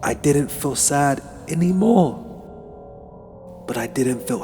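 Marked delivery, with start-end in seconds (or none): none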